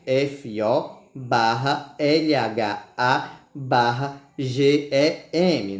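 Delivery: slow letter-by-letter spelling. Shown as a demonstration. Teacher spelling folhagem slowly.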